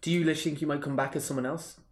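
usual speaking voice

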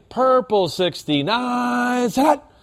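slow, high "purple sixty-nine" into "said hut"; drawn-out second call. Shown as a demonstration. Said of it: "When you get to that perp".

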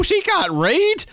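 high, slow teasing put-down; mock-pitying jab. Real, not acted. Podcaster joking that the model can't read, high and teasing. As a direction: deliver the jab with relish.

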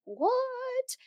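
high, soft, drawn-out confusion; confused. Acted. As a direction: act out a baffled, quiet reaction. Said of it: "like, confused".

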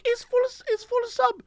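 very high, soft put-on voice; poor little woman. Flustered Subway worker answers complaining customer, poor little woman. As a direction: plead in a tiny, high woman's voice. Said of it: "and she's like".

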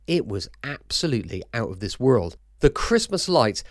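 ordinary delivery

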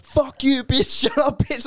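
high-pitched mock yell, breaking into a laugh. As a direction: imitate a group yelling someone down. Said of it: "just yelling at this chick".

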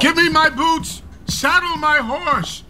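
high, urgent barked orders; urgent command. Acted. Father ordering his horse to ride to the king, urgent. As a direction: command the servants in haste.

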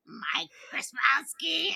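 high breathy whisper, slow; whispered like the Monsters Inc secretary; raspy secretary voice. Retold or imitated. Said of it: "she's like".

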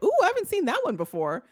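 high-pitched pretend "ooh" of discovery; mock surprise. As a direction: play at being struck by something new. Acts out reaction to novel racism.

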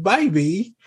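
put-on lightly posh British baby, high on this is; mock Dorit accent. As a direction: tease like Dorit. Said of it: "be like".

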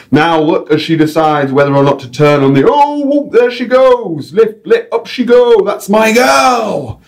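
high, slow, broad North English showman voice; North English guide. Acted. Guide on his radio mic, North English accent. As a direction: announce the bird in a chirpy North English accent. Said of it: "random North English accent".

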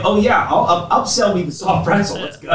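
loud, pumped upsell; mock eager customer. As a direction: cheer yourself into the pretzel. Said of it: "then I'm like".

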